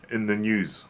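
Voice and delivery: ordinary delivery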